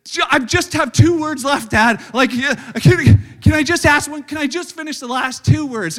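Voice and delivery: loud, high mock child begging; loud pleading kid. Son begs dad at bedtime, loud pleading. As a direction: beg loudly like a kid stalling bedtime. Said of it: "he's like".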